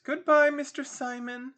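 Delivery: high ladylike farewell, softening after a loud start; Missus Captain Waters. Acted. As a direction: call out high, then ease off. Missus Waters bids Simon farewell.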